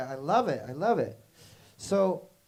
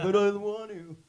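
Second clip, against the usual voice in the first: loud, high imitation whine; mock whining protest; 7 semitones higher, 7 dB louder than usual. Imitating dad told to stop singing, mock whining.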